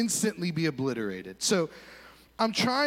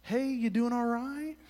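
mock concerned student; 7 semitones higher than usual. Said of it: "they're like".

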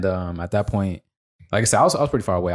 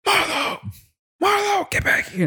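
very high-pitched mock yell; imitated yelling; slower than usual. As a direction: mimic a teammate calling out, without real volume. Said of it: "Wesley keep yelling at me".

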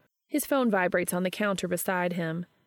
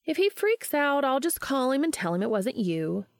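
higher, light and carefree; breezy; 7 semitones higher than usual. A narrator's reading. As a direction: brush it off airily. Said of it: "Charlie says breezily".

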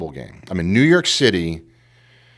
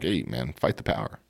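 muttered defiance; 7 semitones lower, 11 dB quieter than usual. Retold or imitated. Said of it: "I just was like".

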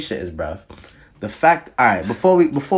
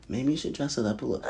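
softer retold hint, voice dropped; retold suggestion; 11 dB quieter than usual. Hinting she should dress up, retold soft suggestion. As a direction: drop the volume for a quoted gentle hint. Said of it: "I'm here like".

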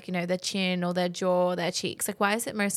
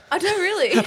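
gasp of surprise; 11 semitones higher, 4 dB louder than usual. Real, not acted. Reacts in shock to filler.

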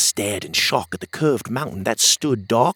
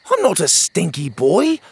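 put-on much higher, louder kid protest; a little boy insisting he's clean; young boy; 12 semitones higher, 5 dB louder than usual. Pockets protesting to Otis, high indignant kid voice.

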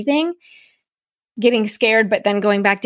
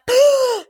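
sharp high gasp; gasp for air. Retold or imitated. Surfacing and gasp.

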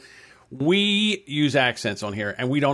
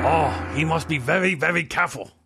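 mock Japanese accent; 3 semitones higher than usual. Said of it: "Hold on".

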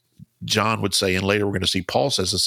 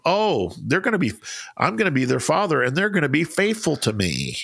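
higher, swooping mock-confident voice; ironic statement; 7 semitones higher than usual. Speaker voicing God's hopes for his people, ironic. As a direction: play up the naive optimism with lilting pitch. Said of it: "that ironic statement of".

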